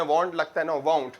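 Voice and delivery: normal talking voice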